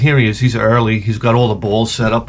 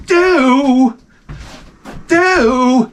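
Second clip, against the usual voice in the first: mock dance hum; 12 semitones higher than usual. Comic imitates the coach humming as he dances. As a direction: hum his showy ball dance.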